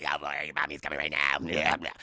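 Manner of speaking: very low, loud demonic growl; deep demon voice. Retold or imitated. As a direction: rumble it low like a possessed mom. Possessed mother demon voice.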